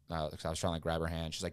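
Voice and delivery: ordinary talk, nothing marked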